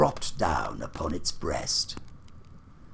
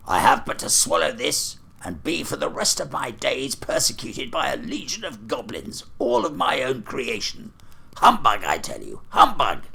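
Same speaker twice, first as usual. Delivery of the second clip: put-on old miser growing louder to a shouted humbug; blustering Scrooge; 4 semitones higher, 4 dB louder than usual. Scrooge defies the ghost, blustering up to a shout. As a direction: bluff it out, then bark humbug. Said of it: "Scrooge blusters to ghost".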